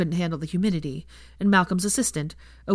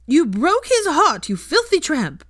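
high, loud furious shriek; a howler letter shrieking abuse; screamed howler; 11 semitones higher, 7 dB louder, slower than usual. Acted. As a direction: scream the insult at her.

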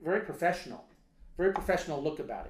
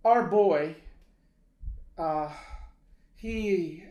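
put-on loud, higher Lebanese-accented English with pauses; halting Lebanese accent; 3 semitones higher, 7 dB louder, much slower than usual. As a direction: imitate a Lebanese father struggling to go on. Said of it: "he goes, uh".

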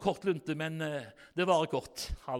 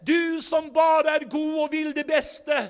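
fervent prayer; 8 semitones higher, 7 dB louder than usual. Retold or imitated. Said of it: "og så sa de".

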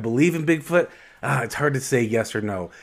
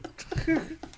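high howling creature call; Bigfoot call; 8 dB quieter than usual. Pretend. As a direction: howl like a Bigfoot. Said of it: "Can you do a Bigfoot call?".

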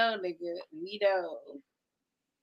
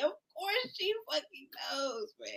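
fading laughter; 5 semitones higher, 11 dB quieter, slower than usual. Real, not acted. Laughing, winding down.

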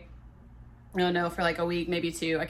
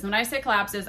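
normal talking voice

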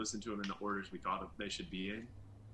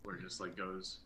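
usual speaking voice

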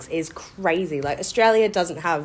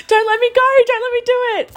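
much higher, louder, pleading cry; tearful pleading; 6 dB louder than usual. Retold or imitated. Leaving her family at the airport, high tearful plea. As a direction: beg them not to let you leave. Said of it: "And I was like".

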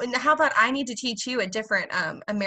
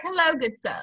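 mock British accent; 6 semitones higher than usual. American mocks her own outdated British, in a stagey British accent. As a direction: ham up a period-film British accent. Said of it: "no one speaks like that here".

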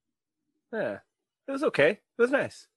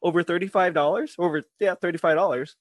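upbeat sponsor read, pitched up; 6 semitones higher, 6 dB louder than usual. Acted. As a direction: sell it like a podcast ad.

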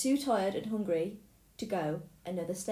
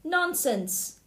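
dismissive wife; 8 semitones higher, 5 dB louder than usual. Acted. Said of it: "said the giant's wife".

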